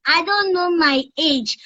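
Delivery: put-on high innocent child voice; a little kid who can't say her age; baby voice. Child character answering caller on radio. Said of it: "just use that baby voice".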